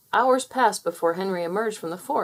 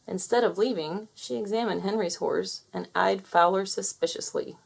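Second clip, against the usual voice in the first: plain reading voice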